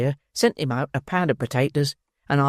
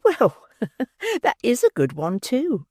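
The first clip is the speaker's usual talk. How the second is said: higher, giggling; laughing matron; 10 semitones higher than usual. A narrator's reading. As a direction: chuckle it out.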